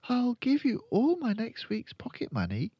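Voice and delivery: put-on high little-girl voice; high girl character; admiring Matilda. A narrator's reading. Matilda bargains.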